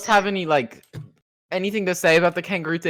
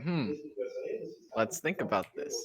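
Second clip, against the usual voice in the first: musing; 4 semitones lower, 14 dB quieter than usual. Retold or imitated. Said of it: "I was like".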